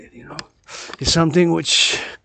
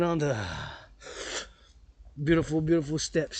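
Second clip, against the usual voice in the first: usual speaking voice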